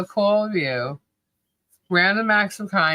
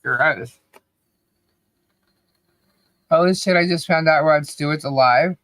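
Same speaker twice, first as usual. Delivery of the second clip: ordinary talk, nothing marked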